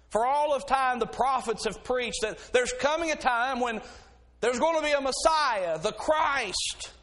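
building, rising preacher cry. Acted. Preacher rising to full force over the congregation.

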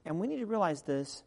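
normal talking voice

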